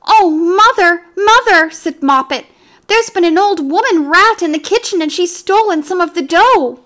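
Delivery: scared kitten tells mother about the rat; frightened kitten. A narrator's reading. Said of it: "in a terrible fright".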